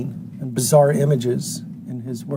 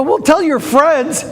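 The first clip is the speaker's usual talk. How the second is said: high, slow and emphatic, leaning on tell; playful urging; 11 semitones higher, slower than usual. Retold or imitated. Host urging the fishing-hole viewer, drawn-out playful plea. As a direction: coax him to spread the word, drawn out.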